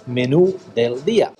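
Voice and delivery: menú del día in clean Spanish, menú stressed on nu; teaching menú del día as locals say it; Spanish pronunciation. Shown as a demonstration. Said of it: "so you stress that letter".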